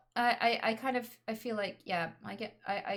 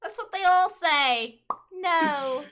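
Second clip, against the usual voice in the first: silly put-on voice; 8 semitones higher, 10 dB louder, much slower than usual. Continuing the joke.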